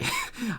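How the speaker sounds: short high giggle at his own slip; embarrassed giggle. Real, not acted. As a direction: giggle it off, a little flustered. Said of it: "I got, I got choked up".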